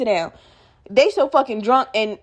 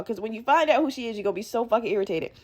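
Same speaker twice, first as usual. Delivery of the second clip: ordinary delivery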